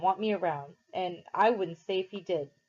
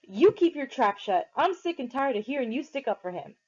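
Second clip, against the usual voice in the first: angry snap; 6 semitones higher than usual. A narrator's reading. Derry turning on Soda Pop, angry snap. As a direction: shut him down hard.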